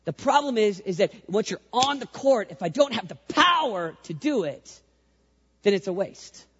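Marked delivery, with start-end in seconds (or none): none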